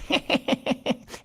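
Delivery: high, rapid mischievous giggle; gleeful snicker. A narrator's reading. Mouse gloating to himself over his trick, gleeful high snicker.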